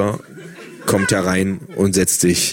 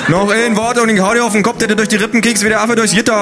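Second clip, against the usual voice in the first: calling out; 4 dB louder, faster than usual. A narrator's reading. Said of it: "ruft Hertha".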